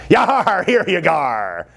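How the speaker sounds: drawn-out, hammed-up pirate growl. Teacher playing magician for the class, silly pirate voice. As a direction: do a silly pirate, stretching the vowels. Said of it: "Should I do some pirate voice?".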